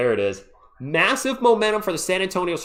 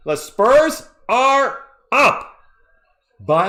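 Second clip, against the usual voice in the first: very slow, high, loud drawn-out call; thrilled fan stretching out the lead call; excited call; 8 semitones higher, 6 dB louder, much slower than usual. Real, not acted. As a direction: stretch every word, thrilled. Team takes lead in playoffs.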